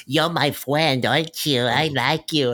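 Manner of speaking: high, drawn-out little-kid imitation; mock ten-year-old. Mocking a grown actress playing a kid in the movie, putting on a ten-year-old voice. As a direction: play an adult overdoing a child's voice. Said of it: "talk like a ten-year-old".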